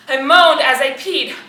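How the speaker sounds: loud, emphatic repeat; amazed, emphatic confession. Acted. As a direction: burst out with the admission.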